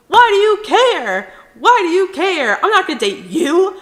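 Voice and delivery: high, loud outraged retort; acted furious snap at an ex; angry. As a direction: yell it back in indignation. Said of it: "I'm still angry".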